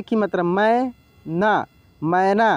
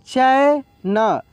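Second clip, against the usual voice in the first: drawn-out reading; 3 semitones higher, much slower than usual. Shown as a demonstration. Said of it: "slow then normal reading".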